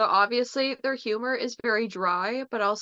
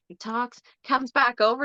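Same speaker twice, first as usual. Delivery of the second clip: ordinary delivery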